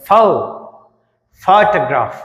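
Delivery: ఫౌ alone, then photograph with that opening syllable strong; stress on first syllable. Shown as a demonstration. Teacher breaking down the word, first syllable of photograph stressed. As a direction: isolate ఫౌ, then hit it in photograph. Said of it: "ఫోకి ఎక్కువ stress ఇచ్చాం".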